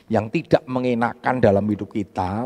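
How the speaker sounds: ordinary delivery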